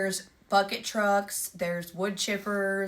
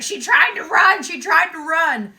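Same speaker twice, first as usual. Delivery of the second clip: high, loud, panicky repeat; flustered panic; 8 semitones higher, 9 dB louder than usual. Retold or imitated. Stranger to the returning owner, mock panic, high and loud. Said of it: "he's, like, in distress".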